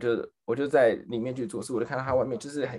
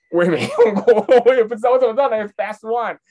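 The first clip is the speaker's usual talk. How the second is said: loud, higher-pitched comic protest; flustered protest; 6 semitones higher, 9 dB louder than usual. Retold or imitated. Clerk asked to recommend a product, flustered protest. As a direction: protest loudly that you have no clue.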